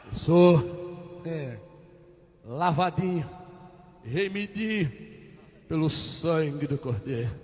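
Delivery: small, low, hesitant voice; meek answer. Acted. Patient cowed by the sergeant's questioning, meek low voice. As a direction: shrink the declaration, halting and soft.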